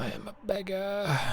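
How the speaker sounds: mock whimpering. High far crying voice.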